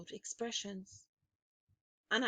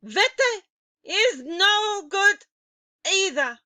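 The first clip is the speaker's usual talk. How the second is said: scornful witch; 11 semitones higher, 12 dB louder than usual. A narrator's reading. Grand High Witch dismissing the audience's promise, loud. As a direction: slam it down in contempt.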